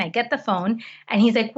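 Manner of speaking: normal talking voice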